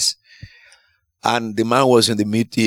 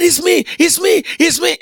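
excited cry. Retold or imitated. Healed man answering the preacher, high excited cry. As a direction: blurt it out overjoyed.

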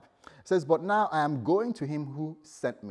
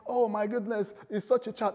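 higher, pleading cry; hammed-up plea for mercy; mock begging; 7 semitones higher than usual. Congregation begging the collectors.

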